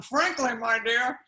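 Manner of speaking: loud playful quote of the film line; movie quote. Acted. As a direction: toss off the famous line with glee. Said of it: "I know that one".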